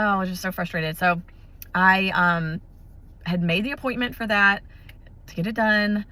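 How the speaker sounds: normal talking voice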